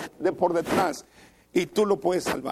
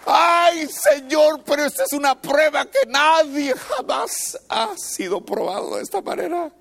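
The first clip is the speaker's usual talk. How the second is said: pleading wail; 9 semitones higher than usual. Acted. Believer complaining to God, high sung wail.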